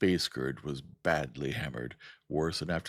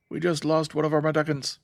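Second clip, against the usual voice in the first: put-on high, choppy Beowulf accent, loud; staccato Beowulf accent; 11 semitones higher, 8 dB louder than usual. A narrator's reading. Captain reporting a lost ship, in a loud staccato Beowulf accent. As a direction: bite off each word in a Beowulf accent. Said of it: "in his staccato Beowulf accent".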